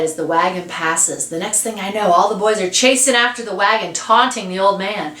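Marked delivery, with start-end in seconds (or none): none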